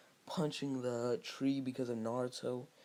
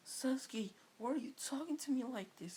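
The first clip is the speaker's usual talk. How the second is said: put-on much higher hurt girlish voice; Sakura voice; 13 semitones higher than usual. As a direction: ask it high and wounded.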